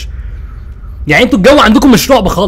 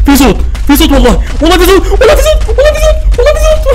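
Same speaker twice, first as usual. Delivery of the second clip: climbing higher and louder into a cry and shout; rising panic; 3 dB louder than usual. Real, not acted. Streamer hearing a noise in the game, panic climbing to a shout. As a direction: panic builds with each repeat until it breaks.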